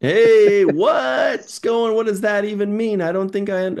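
loud, high burst of confusion; mock baffled outburst. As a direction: blurt it out high, hammed-up bewilderment. Acts out a baffled reaction.

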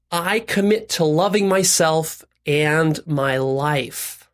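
very slow, phrase-by-phrase pledge; deliberate recitation. Shown as a demonstration. Coach modelling a commitment for listeners, deliberate recitation.

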